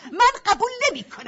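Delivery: high, loud angry shout; angry refusal. Acted. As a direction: snap back a flat no. Sister refuses the deal.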